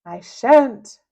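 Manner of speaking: loud defiant cry; a hero loudly refusing. Acted. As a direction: fling the refusal back at him. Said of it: "cried Captain Underpants".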